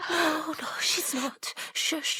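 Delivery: soft whispered denial; hushed whisper. Acted. Hiding in the cabin, reassuring in a whisper. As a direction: barely breathe it.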